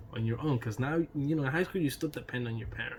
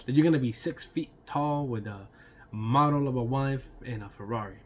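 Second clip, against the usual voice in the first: normal talking voice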